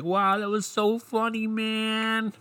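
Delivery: high, put-on voice of a wowed listener; lightly hammed-up bro reaction; mock impressed crowd. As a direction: act out the crowd loving the joke. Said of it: "we would all been like".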